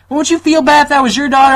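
put-on high, loud Southern-accented guilt trip; southern mom. Mom shaming her son, Southern accent. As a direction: imitate a Southern mom pleading. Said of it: "she has a southern accent".

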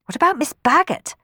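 anxious question. Acted. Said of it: "asked Sophie anxiously".

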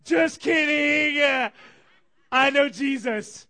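put-on slow, loud, slurred drunk shouting; rowdy drunk guy. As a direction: belt it out like a plastered partygoer. Drunk partygoer voiced in story.

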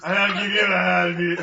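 booming playful line; loud mock dubbing. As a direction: belt it out as a joke.

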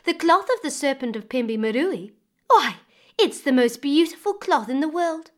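high, loud, gushing praise; enticing. Acted. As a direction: sell the dream of the cloth, bright and eager. Woman praising the serpent cloth.

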